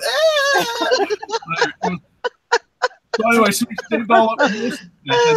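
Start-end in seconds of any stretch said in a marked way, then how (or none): 0.1-2.0 imitated scream. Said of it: "she'd just stand there going".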